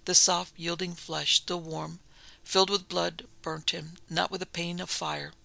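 usual speaking voice

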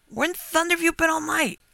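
high, incredulous country farmer; exclaiming. A narrator's reading. Farmer questioning the motorist about his night, exclaiming. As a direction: demand it in high-pitched amazement. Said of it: "he exclaimed".

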